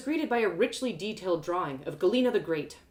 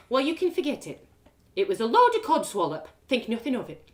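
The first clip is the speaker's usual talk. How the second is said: brisk mum; 5 semitones higher, 5 dB louder than usual. Acted. Mum dismisses the gossip.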